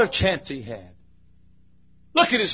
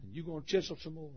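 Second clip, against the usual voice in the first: much softer, quick, inward; hushed plea; 2 semitones higher, 14 dB quieter, faster than usual. Acted. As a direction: say it quietly, almost to yourself. Same plea, now hushed.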